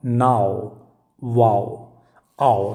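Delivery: नाओ, वाओ, आओ low, with a full "ow" glide; low, drawn "ow" in नाओ, वाओ, आओ; diphthong demo. Shown as a demonstration. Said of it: "आपका ये sound क्या है".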